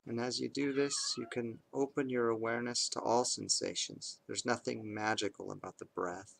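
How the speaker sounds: calm, measured meditation voice with long pauses; guided meditation. Acted. Parent leading kids through bedtime meditation, calm and measured. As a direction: guide them unhurriedly into awareness of the breath, leaving space between phrases.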